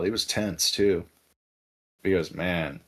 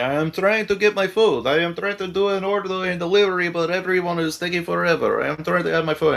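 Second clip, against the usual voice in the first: higher-pitched mock exasperation; imitated agitation; 5 semitones higher than usual. DoorDash guy waiting on an order, mock agitated complaint. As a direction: complain like a customer kept waiting. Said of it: "one guy is getting super agitated".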